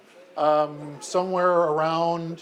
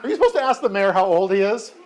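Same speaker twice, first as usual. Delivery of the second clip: mock-offended surprise, higher and louder; mock shock; 5 semitones higher, 6 dB louder, faster than usual. Real, not acted. Mayor teased by child about his age, mock-surprised. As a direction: play scandalized, then laugh it off.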